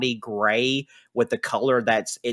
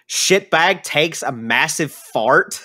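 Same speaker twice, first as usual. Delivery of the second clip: usual speaking voice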